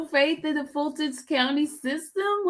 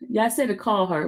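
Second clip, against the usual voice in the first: ordinary delivery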